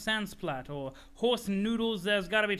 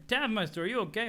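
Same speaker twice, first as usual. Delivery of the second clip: ordinary delivery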